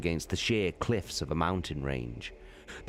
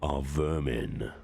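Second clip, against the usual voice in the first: Horus the warlord. Acted. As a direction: weigh each word like a general passing sentence. Horus explains his contempt.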